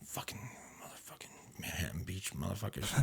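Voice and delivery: whispered swearing under the breath; muttered cursing. Retold or imitated. Clerk cursing customers under his breath, whispered. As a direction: curse them in a hushed mutter.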